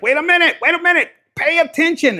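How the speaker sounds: high, loud interruption; agitated devil. Acted. Devil stopping everyone to pay attention, high urgent call. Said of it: "even the devil's like".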